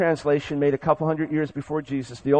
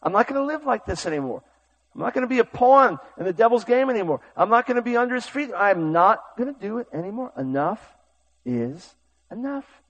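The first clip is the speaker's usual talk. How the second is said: mock-defiant vow, pitched higher; a rising stand against the devil; defiant resolve; 6 semitones higher than usual. Believer declaring to the devil, defiantly. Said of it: "You have to have the attitude".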